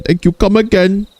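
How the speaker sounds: put-on high-pitched caricature Indian accent; exaggerated Indian-accented politeness; mock Indian accent. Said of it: "Lois is now Indian".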